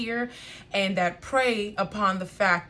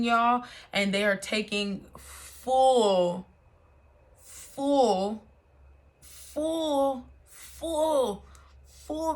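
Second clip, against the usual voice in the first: full stretched slow, climbing higher each time; drawn-out emphasis; 3 semitones higher, much slower than usual. Real, not acted. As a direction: repeat full, rising and slower.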